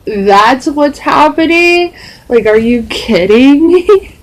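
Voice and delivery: high, drawn-out incredulous questions; acted disbelief. Daughter told by mom her ear is infected, acted incredulity. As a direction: climb high in exaggerated disbelief.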